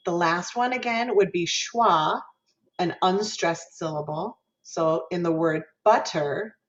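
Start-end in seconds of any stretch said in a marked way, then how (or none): none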